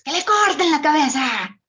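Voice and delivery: high, loud furious royal command; Queen of Hearts. Retold or imitated. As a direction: bark the order like a mad queen. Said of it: "la reina de corazones de Alicia". (la Reina de Corazones gritando furiosa)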